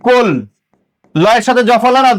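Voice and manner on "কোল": কোল with অ turned to ও, closed on ল; demonstrated pronunciation. Shown as a demonstration. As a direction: sound out কোল as one separate syllable. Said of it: "তাহলে কি হবে?". (ও দিয়ে উচ্চারণ: কোল)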